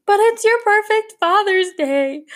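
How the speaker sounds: high, loud mock-distraught whine; upset wail. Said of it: "I'm so upset and getting so much anxiety".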